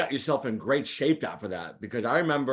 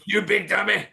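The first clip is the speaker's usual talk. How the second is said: higher mock blurt of the catchphrase; blurted Sanford catchphrase; 8 semitones higher, 3 dB louder than usual. Host blurts at the guest, a higher mock blurt. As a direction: blurt it out of nowhere. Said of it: "like a Tourette syndrome person, goes".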